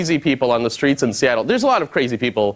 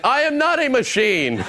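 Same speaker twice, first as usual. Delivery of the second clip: high, slow protest; a light, deliberate robotic plea; mock robot denial; 9 semitones higher than usual. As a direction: insist you are human. Insisting he is human.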